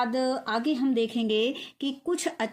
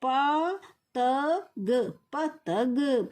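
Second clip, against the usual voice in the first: slow spelling demo; much slower than usual. Shown as a demonstration. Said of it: "क्या दिया गया?".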